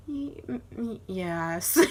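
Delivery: stammered, reluctant mock admission; hesitant confession. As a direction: stall and stumble before owning up. Acting out reluctant confession to mom.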